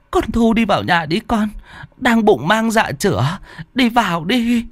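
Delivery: put-on old mother wailing high for her son; old mother calling. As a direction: cry out to your son through the storm, high and pleading. Mother sends daughter-in-law inside.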